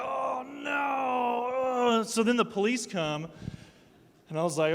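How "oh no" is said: put-on scared kids. Kids dread police.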